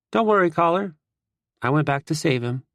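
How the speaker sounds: lightly voiced cheeky teammate; proud brag. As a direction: brag about the rescue. Teammate bragging to captain.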